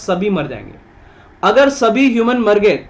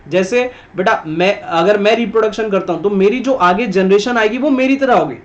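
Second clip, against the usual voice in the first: usual speaking voice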